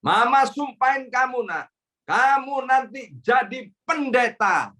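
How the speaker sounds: mother loudly declaring her son will become a pastor; mother's curse. Retold or imitated. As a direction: proclaim it like a mother invoking her son's destiny. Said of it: "Dan mama saya bilang".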